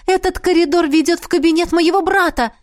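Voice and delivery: high-pitched happy announcement; excited, lifted voice; cheerful. A narrator's reading. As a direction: announce good news merrily. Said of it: "неожиданно весело добавила королева".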